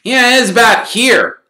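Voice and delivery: loud, high-pitched call. Acted. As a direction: point it out loudly, pitched well up. Ron pointing the spot out aloud.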